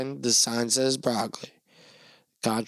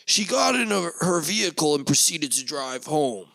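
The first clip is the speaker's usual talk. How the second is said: mock news report; 3 semitones higher than usual. Podcaster tells Goldilocks as breaking news, hammed-up anchor. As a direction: play it like a cheesy crime bulletin.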